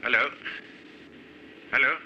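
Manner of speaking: ordinary talk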